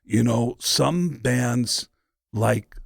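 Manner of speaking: lightly voiced, slow and softening. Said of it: "the analogies that [name] would say".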